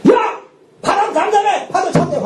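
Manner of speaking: high, shouted angry command; angry rebuke. Retold or imitated. Jesus rebukes the wind and waves in anger. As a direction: scold the wind like an angry master. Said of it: "화를 내잖아".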